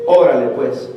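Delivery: mildly annoyed, low "Órale, pues"; a hint of an angry Mexican convert brushing off the pastor; annoyed retort. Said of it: "el mexicano enojado le dijo".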